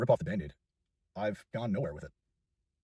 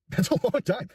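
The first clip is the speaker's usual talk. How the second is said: laughing through the words, higher and louder; 9 dB louder, slower than usual. Real, not acted. To viewers about ten years of YouTube, laughing. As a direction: crack up as you say it. Laughing at his own decade.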